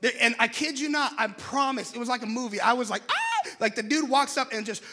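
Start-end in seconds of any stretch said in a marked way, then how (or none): 3.1-3.5 very high short squeal; mock scream. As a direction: squeal as if watching an action movie. Amazed reaction to movie-like moment.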